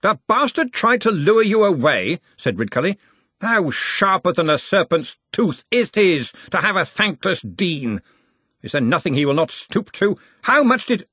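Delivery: put-on a hearty old wizard in charge; Ridcully's voice.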